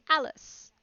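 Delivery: high, loud piping call; shrill rabbit cry. A narrator's reading. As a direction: pipe the name out at the top of your voice. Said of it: "at the top of his shrill little voice".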